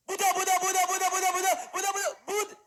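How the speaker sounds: retold panicked yell, chanting the name over and over; shouting a name. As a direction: call out the name again and again in panic. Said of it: "biglang sumigaw siya". (pasigaw na paulit-ulit na pagtawag)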